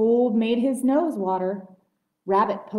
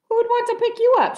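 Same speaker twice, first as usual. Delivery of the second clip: quick, high, scoffing jeer; mocking; 7 dB louder, much faster than usual. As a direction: tease with a sneer. Sheep mocking, then laughs.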